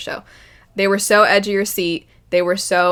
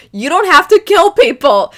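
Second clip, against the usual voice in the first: higher, louder indignant snap; outraged, raised voice; angry retort; 9 semitones higher, 9 dB louder than usual. Retold or imitated. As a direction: scold the villain hard. Retorting to villain's excuse.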